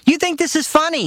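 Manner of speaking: very high indignant father; father scolding mother; outraged father. Retold or imitated. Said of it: "he said".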